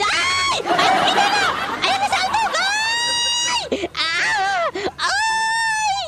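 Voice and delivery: frantic screams and wails, very high; high-pitched wailing. Real, not acted. Gamer being chased by an enemy, high wailing screams. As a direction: wail it out in a panicked squeal.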